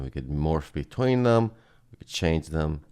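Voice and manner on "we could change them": ordinary talk, nothing marked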